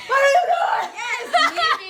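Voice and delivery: very high, loud screamed demand; yelling in ear. Retold or imitated. Sergeant yells right in the recruit's ear, screaming. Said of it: "he yelled in my ear exactly how she did".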